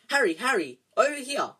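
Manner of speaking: high, loud call across a street. Acted. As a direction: shout to get his attention. Hermione calls across street.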